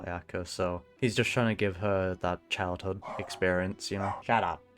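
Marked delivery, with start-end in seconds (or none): none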